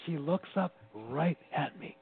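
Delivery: ordinary talk, nothing marked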